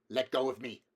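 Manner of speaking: a son commanding his mother; iron command. A narrator's reading. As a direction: order it with cold steel. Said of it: "the iron in his voice".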